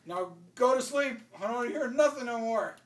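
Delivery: retold stern shout; imitated scolding. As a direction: lay down the law as grandfather. Grandfather scolds children.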